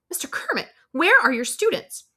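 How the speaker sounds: high alarmed exclamation; shocked teacher's high cry; exclaiming. Acted. Neighbouring teacher finds the empty classroom, high surprised exclamation.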